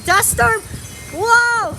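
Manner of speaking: awed, pitch climbing high; excited. Real, not acted. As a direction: gasp at the storm. Storm hits, filming it.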